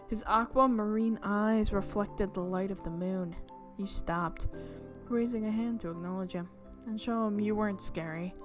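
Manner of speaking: usual speaking voice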